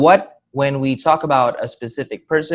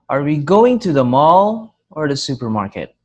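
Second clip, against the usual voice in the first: MALL rising, SUPERMARKET falling; rise, then fall; 3 semitones higher than usual. Shown as a demonstration. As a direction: lift MALL, then drop the pitch on SUPERMARKET. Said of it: "has a rising tone".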